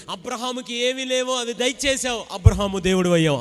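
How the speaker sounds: Hannah's prayer cried out loud. Acted. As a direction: pray it out loud to God as Hannah. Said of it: "Hannah ఇలా ప్రార్థన చేసి ఉంటది". (Hannah ప్రార్థనను గట్టిగా పలుకుతూ)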